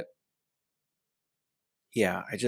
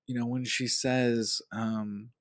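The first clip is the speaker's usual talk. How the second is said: ordinary talk, nothing marked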